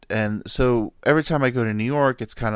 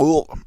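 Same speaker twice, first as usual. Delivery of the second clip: loud, higher-pitched grunted ugh; straining grunt; 6 semitones higher, 7 dB louder than usual. Retold or imitated. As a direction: imitate a cat straining hard. Imitates cat straining in litter box.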